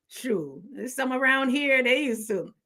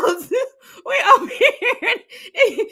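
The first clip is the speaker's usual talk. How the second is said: squealed through laughter, higher; words lost in a fit of laughter; laughing high and slow; 12 semitones higher, 5 dB louder, slower than usual. Real, not acted. Laughing hard.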